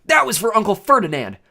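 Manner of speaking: high, loud defiant yell; triumphant shouted taunt; yelling back. A narrator's reading. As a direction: yell vengeance across the fight. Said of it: "Grover yelled back".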